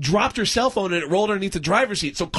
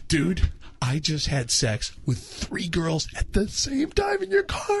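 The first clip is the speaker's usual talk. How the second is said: retold confession; 2 semitones lower, 4 dB quieter, slower than usual. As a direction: confide it slowly, then pitch up incredulously. Retold confession to his friend.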